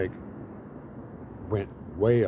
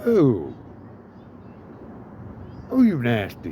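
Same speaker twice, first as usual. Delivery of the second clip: louder, hammed-up shocked reproach; mock shocked ooh; 3 semitones higher, 4 dB louder, slower than usual. After she punched him, a hammed-up shocked scolding. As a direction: tease her for the punch, mock-stung. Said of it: "I'm just like".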